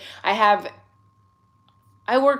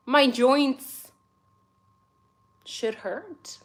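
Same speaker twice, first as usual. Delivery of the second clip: ordinary talk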